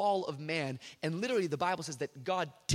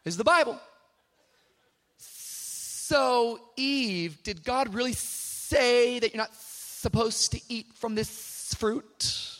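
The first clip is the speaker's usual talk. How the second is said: slow, drawn-out tempting serpent voice; sly serpent; 3 semitones higher, much slower than usual. Acted. Serpent slowly tempting Eve, a lightly voiced snake. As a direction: coax her like a snake, stretching the words. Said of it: "A serpent says".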